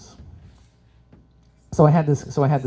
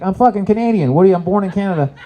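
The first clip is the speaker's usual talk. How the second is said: loud, higher exasperated outburst; indignant protest; 6 semitones higher, 7 dB louder than usual. Retold or imitated. Son snapping at mom in Russia, indignant. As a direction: fire it back at mom, offended. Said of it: "And I'm like".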